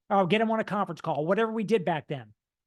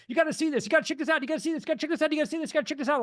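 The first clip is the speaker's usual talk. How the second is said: higher, rapid, breathless urgency; overly excited; 7 semitones higher, much faster than usual. Retold or imitated. Calling friends about the business, high and excited. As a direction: gush like you can't hold it in. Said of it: "Was I talking a little fast there? Was I overly excited?".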